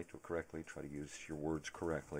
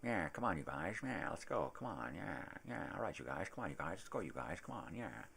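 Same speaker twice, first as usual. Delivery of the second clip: swooping Edward G. Robinson-style dog voice; rally the gang in repeated up-and-down bursts; cartoon gangster dog egging everyone on; Sam; 3 semitones higher than usual. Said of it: "Sam sounds like".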